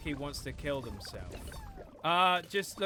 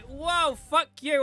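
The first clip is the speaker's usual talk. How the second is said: mock taunt; 10 semitones higher, 8 dB louder, slower than usual. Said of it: "it's not like".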